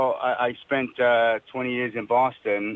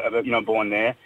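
ordinary talk

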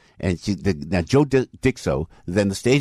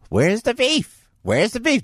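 put-on high, squawky old-lady shout; Clara Peller catchphrase; 14 semitones higher, slower than usual. Old lady pops up from under the counter, high shrill squawk. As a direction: pop up and screech it like a tiny old lady. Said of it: "she was supposed to pop up and go".

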